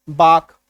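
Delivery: बाक with no L before the K; silent L. Shown as a demonstration. Teacher reading balk after cutting the L, silent L. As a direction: say बाक slowly, long vowel into K. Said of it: "अब पढ़ो इसको".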